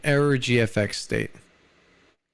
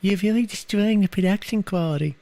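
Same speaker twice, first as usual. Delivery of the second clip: high, put-on voice, slowly shushing the room; fussy streamer; 8 semitones higher than usual. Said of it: "could I still go in and be like".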